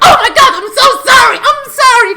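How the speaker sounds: high, loud, panicked apology shouted in a put-on voice; mock the other driver's frantic sorry. The other driver after the crash, apologizing in a loud panic. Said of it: "the other guy was like".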